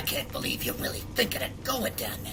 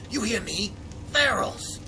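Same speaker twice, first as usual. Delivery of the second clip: emphatic warning; 8 semitones higher than usual. Acted. Stresses the danger of the dogs.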